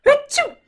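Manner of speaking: a big high acted achoo; loud sneeze. Shown as a demonstration. Demonstrating a cold for children, loud sneeze. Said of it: "and you sneeze".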